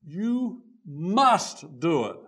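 a hint of a stern parent telling a child; slow, weighted "must" like a lightly voiced strict parent; firm parent. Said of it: "If you tell your child".

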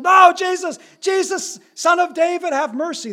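high, loud pleading cry for mercy; desperate cry. Acted. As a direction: beg Jesus across the crowd. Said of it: "This is the cry".

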